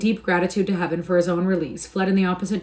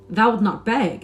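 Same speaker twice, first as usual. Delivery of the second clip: louder, incredulous outburst; surprised exclaim; 4 semitones higher, 6 dB louder, slower than usual. A narrator's reading. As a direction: Hugo can't believe his ears. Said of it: "exclaimed Hugo".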